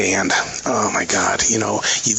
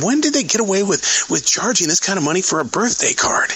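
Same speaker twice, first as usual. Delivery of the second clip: mock outrage, pitched much higher; imitating a shocked customer, much higher; indignant customer; 9 semitones higher than usual. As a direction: play the grumbling old customer, high and huffy. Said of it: "He said".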